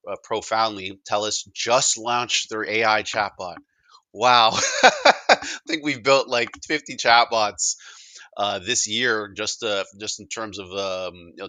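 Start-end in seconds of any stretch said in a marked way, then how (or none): 4.1-7.8 loud, higher, slowed by laughter; laughing amusement. Real, not acted. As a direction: laugh through the words in amused wonder. Laughs at a late chatbot launch.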